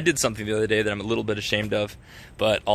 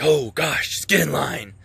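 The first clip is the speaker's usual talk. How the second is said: exasperated; 5 semitones higher, 4 dB louder, slower than usual. Retold or imitated. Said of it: "The father doesn't look at us and be like".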